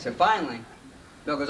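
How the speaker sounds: normal talking voice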